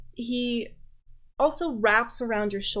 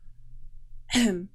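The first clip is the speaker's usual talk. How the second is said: pointed little throat clear. Acted. Noble changing the subject with the guest, throat clearing. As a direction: clear the throat before speaking. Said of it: "clears his throat and goes".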